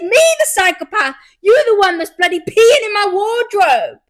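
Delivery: high, loud outraged retort; indignant shout. Retold or imitated. Arguing with sleepwalking boyfriend, loud indignant shout. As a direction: throw it back at him. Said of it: "I said".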